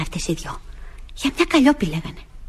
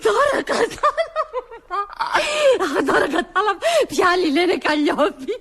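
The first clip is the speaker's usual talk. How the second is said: high-pitched helpless laughter through the words; laughing hard; 9 semitones higher than usual. Acted. Girl grasps the mix-up to the master's dismay, laughing helplessly. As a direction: squeal it out between fits of laughter. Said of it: "κοίτα που γελάει".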